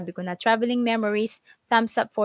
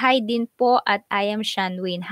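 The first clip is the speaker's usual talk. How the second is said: usual speaking voice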